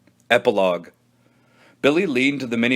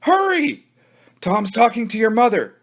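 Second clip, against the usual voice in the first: put-on urgent kid; 9 semitones higher than usual. Pete urgent.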